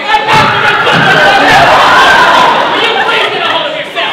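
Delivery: very loud, high bellow; full-force exasperated shouting; exasperated yell. Acted. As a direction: yell at them to get a grip. Yelling at the hysterical man.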